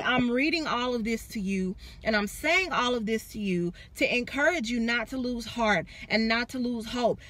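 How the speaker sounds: ordinary delivery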